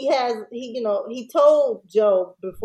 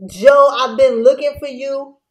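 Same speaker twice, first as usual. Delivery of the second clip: mock tough yell; 4 semitones higher, 8 dB louder than usual. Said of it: "and he's like".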